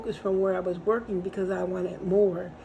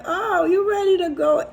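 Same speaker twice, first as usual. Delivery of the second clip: high, loud playful jab; teasing question; 9 semitones higher, 6 dB louder than usual. Retold or imitated. Teasing the host who has his coat on, high and loud. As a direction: tease him about leaving.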